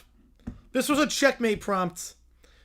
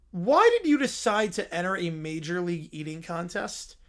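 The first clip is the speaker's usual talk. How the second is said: ordinary talk, nothing marked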